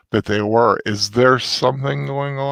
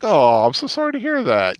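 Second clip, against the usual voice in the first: higher-pitched mock sympathy, laid on thick; dripping sarcasm; 6 semitones higher than usual. Character feigning sympathy at another's loss, dripping with sarcasm. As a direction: put on sarcastic pity for someone. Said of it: "You can just hear the sarcasm just dripping".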